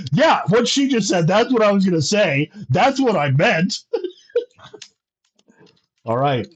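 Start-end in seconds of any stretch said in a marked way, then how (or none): 0.1-3.8 high, loud eager echo of her words; eager agreement. Acted. Sir Arthur chiming in eagerly, louder and higher. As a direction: pipe up fast to claim the idea as your own. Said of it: "so Sir Arthur's like".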